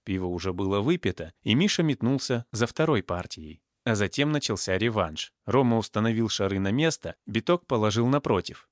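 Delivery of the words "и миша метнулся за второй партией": ordinary delivery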